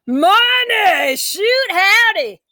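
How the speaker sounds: high, loud gleeful cry, then a slow stretched shoot howdy; thrilled shout. A narrator's reading. Child Orme shouting with joy about tooth fairy money. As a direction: whoop it out like a kid hearing about money.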